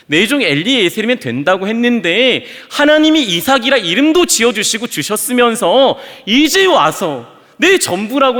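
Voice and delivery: loud, high protesting cry to God; anguished protest. Acted. Abraham protests loudly to God for taking his son. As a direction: throw the complaint up at heaven. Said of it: "하나님께 질문하지 않았을까요?".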